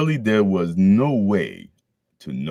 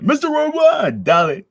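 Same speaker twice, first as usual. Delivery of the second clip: much higher, louder shouted ad-lib; hype shout; 9 dB louder than usual. Pretend. Vlogger riffing on worldwide, shouting hype. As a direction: yell it like a party hype man.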